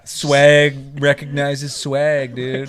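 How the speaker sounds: slow laid-back surfer drawl. Pretend. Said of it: "Why are you saying like a surfer?".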